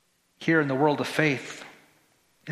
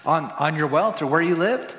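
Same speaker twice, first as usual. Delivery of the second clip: normal talking voice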